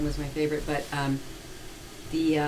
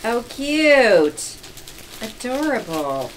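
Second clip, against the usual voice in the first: delighted; 6 semitones higher, 5 dB louder, much slower than usual. Real, not acted. Reacts to gift.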